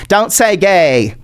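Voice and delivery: slow put-on politician voice; mock DeSantis. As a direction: draw the slogan out in a silly voice. Repeats the slogan as mock DeSantis.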